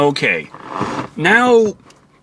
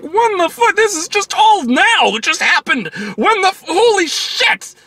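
exasperated disbelief. Retold or imitated. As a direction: yell at the screen in confusion. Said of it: "It's like".

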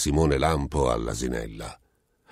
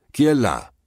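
calling out into the dark; loud sharp call; shout; 8 semitones higher, 5 dB louder than usual. A narrator's reading. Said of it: "gridò Simone Lampo". (gridare nel buio)

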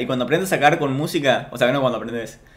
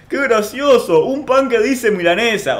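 higher, mocking fake amusement; mocking quote; 7 semitones higher than usual. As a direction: parody the fans' lame laughter. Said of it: "y dicen". (burla aguda de los seguidores)

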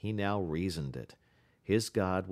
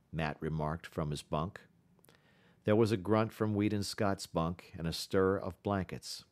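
ordinary delivery

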